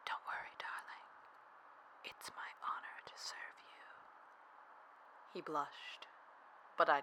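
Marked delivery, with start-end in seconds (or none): 0.0-3.9 hushed, reassuring whisper; intimate, teasing whisper. Acted. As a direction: whisper softly, as if close to the ear. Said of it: "I smirked and whispered".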